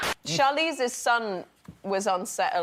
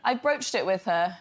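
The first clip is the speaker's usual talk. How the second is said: normal talking voice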